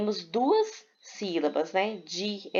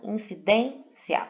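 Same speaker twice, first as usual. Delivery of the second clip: incidência slowly split as in-ci-DÊN-cia; stress on penultimate syllable; slower than usual. Shown as a demonstration. Teacher proving it is paroxytone, incidência slow with stressed DÊN. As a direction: stretch DÊN in incidência, then a separate cia. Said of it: "paroxítona".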